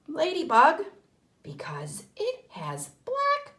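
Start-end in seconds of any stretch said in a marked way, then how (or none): none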